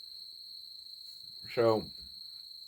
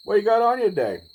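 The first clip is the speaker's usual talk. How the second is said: cop teasing; 11 semitones higher, 9 dB louder than usual. Retold or imitated. Cop asks dad about gun.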